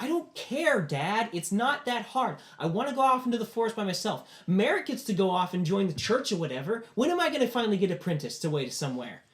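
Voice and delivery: high, loud daughter's tirade; angry yelling. Acted. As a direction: argue back at Dad in an acted angry yell. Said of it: "yelling at him angrily like that".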